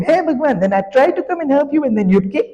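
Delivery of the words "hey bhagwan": mock scream. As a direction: call out to Bhagwan in mock panic. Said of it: "then you'd scream".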